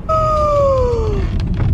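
high thrilled shriek; triumphant shout. Real, not acted. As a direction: let it burst out. Triumphant after arriving.

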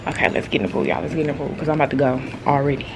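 soft low murmur; low hushed voice. Real, not acted. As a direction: keep it quiet near the pool. Softly speaking at pool.

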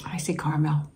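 "caramel" said KAR-mel, two syllables, whispered. Shown as a demonstration. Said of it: "Do you say caramel or caramel?".

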